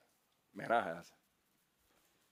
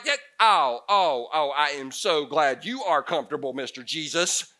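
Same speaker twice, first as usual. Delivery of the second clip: sarcastic disciple; 5 dB louder than usual. Retold or imitated. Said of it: "and you're like".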